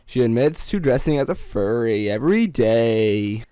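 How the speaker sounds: very low, drawn-out narrator impression; Chills voice. Host imitating a narrator introducing a furry, low mock narration. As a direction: drop low and narrate with slow, loud emphasis. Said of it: "Do you like the Chills voice?".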